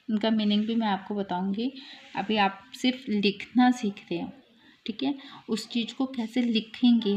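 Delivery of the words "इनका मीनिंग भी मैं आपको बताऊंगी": usual speaking voice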